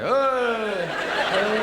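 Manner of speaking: high, loud imitated dog noise; loud dog howl. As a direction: howl out like the happy tongueless dog.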